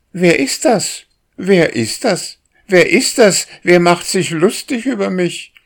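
high, loud startled bird; agitated thrush. A narrator's reading. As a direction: squeak it out like an upset bird. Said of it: "fragte die Drossel".

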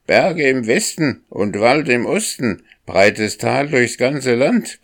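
high rooster voice, calling it out; crowing rooster. A narrator's reading. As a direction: crow the answer up to the sky. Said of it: "krähte der Hahn".

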